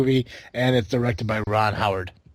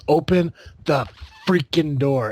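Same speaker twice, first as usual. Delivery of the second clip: raised, slightly drawn-out movie yell; imitated yell; 5 semitones higher, 4 dB louder, slower than usual. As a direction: mimic an actor yelling through a door, half full force. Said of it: "he literally yells".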